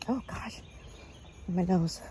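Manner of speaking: usual speaking voice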